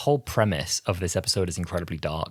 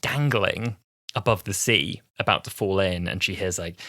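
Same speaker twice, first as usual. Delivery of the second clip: ordinary talk, nothing marked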